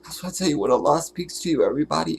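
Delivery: usual speaking voice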